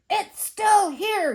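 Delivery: slow, loud, high outburst; furious cry. A narrator's reading. Museum man coming down filled with fury, loud cry. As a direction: cry out in fury, stretching the words. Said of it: "he cried".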